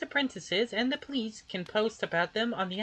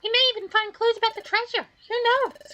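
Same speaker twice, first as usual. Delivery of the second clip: eager, much higher voice full of hope; excited; 13 semitones higher, 4 dB louder than usual. Acted. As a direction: sound thrilled about the treasure. Hopeful talk of treasure.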